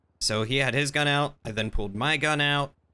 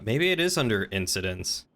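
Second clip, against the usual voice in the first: usual speaking voice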